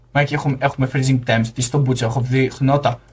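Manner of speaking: ordinary talk, nothing marked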